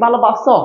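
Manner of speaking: ভালোবাসো said "bhalobaso", with English S; plain s, not sh, in ভালোবাসো; dental s, wrong way. Shown as a demonstration. Teacher asking if pupils say it so, dental s. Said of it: "তুমি দন্ত স দিলে হয়ে যাবে".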